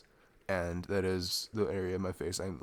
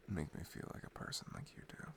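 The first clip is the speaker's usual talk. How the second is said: whispered softly, close and sincere; tender whisper; 11 dB quieter than usual. Real, not acted. Confessing to his girlfriend how she makes him feel, in a tender whisper. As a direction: breathe it like a secret to her.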